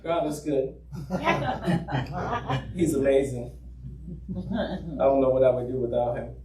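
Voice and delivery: mock bored, lifeless praise; unexcited believer. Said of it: "there's no inflection in my voice".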